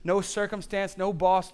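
normal talking voice

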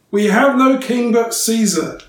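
the priest shouting back at Pilate; shouted back. A narrator's reading. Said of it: "shouted back".

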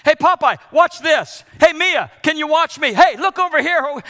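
put-on loud, high, eager child's call for attention; excited kid calling out. Grandkids calling grandpa from the trampoline, loud eager yell. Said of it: "My grandkids constantly say".

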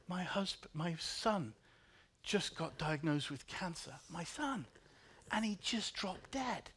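hushed, slow grieving voice; quiet grief. Retold or imitated. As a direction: read her news softly, stunned. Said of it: "She said".

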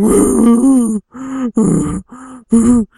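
high, loud wavering moan; drawn-out high cry of pain, acted; imitated pained wail. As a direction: wail like a man just struck. Imitates the struck man.

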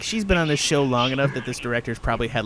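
ordinary talk, nothing marked

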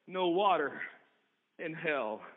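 low, soft refusal sinking away; lightly voiced keeper, low and quiet; grim keeper. Keeper of hell refusing the sister, low and soft.

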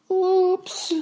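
put-on very high little-girl oops; sheepish. A narrator's reading. Boucle d'Or after breaking the chair, sheepish high squeak. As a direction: squeak it out, embarrassed. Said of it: "dit-elle honteusement".

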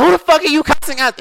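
very high, loud screech imitating the sister; mock yelling. Sister firing back in a row with her sibling, yelled. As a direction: explode in a high-pitched mock scream. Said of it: "You don't have to fucking yell at me".

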